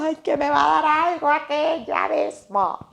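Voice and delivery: mock panic. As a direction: play the dread of being caught out. Her panicked inner reaction.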